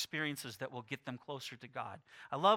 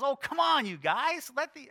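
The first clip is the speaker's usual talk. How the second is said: higher, louder playful exasperation; exasperated Jesus; 9 semitones higher, 7 dB louder than usual. Pretend. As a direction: ham up Jesus scolding the disciples. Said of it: "maybe Jesus didn't talk quite like that".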